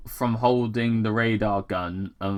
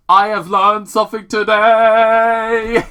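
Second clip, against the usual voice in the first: higher, louder delighted announcement; real excitement, much higher and louder; excited exclamation; 10 semitones higher, 9 dB louder than usual. Real, not acted. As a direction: proclaim it with glee. Reader amused by discovery.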